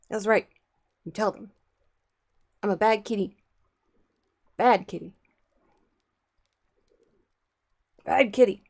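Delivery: loud, playful mock scold. Real, not acted. Playful mock scolding making the cat confess to viewers. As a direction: tell the cat off in jest.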